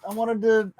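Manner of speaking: high wail of feigned disappointment, easing off; mock dismay. Streamer hears the debates were tonight, mock dismay. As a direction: whine it high as if crushed to miss out.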